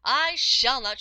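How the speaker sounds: loud cry of refusal; the lass cries out her refusal, loud; cried out. A narrator's reading. Lass refusing the lad, crying out loudly. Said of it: "she cried".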